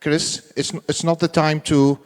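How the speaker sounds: ordinary talk, nothing marked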